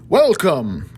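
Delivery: a lightly boomed greeting from the stairs; booming welcome. Acted. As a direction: call it out like a host, a touch bigger. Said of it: "came a booming voice".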